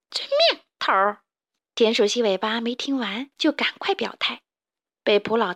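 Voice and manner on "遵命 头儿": squeaky yes-man reply; eager henchman. Acted. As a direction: jump in high, then drop on the title. Henchman answers the boss.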